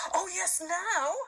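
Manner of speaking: high, eager joy; delighted girl, high; excited cry. A narrator's reading. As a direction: bounce with glee. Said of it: "jumping up and clapping".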